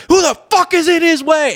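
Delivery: high-pitched mock indignant yell; Ashley's outburst. Retelling Ashley's outrage at a lapper, in a high mock yell. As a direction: play a fan outraged at a blocked rider. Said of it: "she's like".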